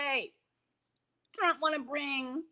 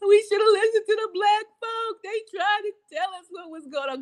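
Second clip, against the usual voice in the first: regretful quote; 7 semitones higher, 5 dB louder than usual. Retold or imitated. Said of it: "you gonna hear 'em say".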